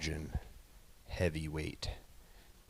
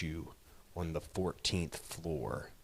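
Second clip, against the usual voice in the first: whispered final threat; menacing whisper; 5 dB quieter than usual. Acted. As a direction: hiss the last word softly. Threat ending.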